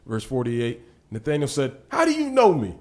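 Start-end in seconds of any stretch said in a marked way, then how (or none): 1.9-2.8 high, loud amazed disbelief; astonished question. Acted. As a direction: ask it stunned, voice leaping up. Nathanael's astonished question voiced.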